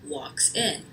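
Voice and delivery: ordinary delivery, nothing marked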